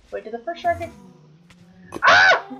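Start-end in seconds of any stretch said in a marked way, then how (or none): 2.0-2.5 startled scream. Real, not acted. Sudden scare in game.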